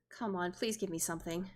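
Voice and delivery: ordinary talk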